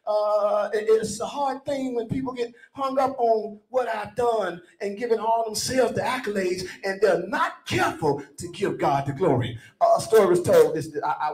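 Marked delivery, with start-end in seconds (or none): 0.1-9.6 fervent, rising preacher's cadence; preaching. Acted. Preacher calling the church to stop boasting, full preaching cadence. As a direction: preach it to the congregation with full force.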